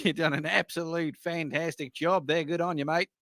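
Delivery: lightly put-on Australian accent, playful; a cheeky Aussie pub compliment, lightly accented. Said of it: "I'm not gonna say any more Australian".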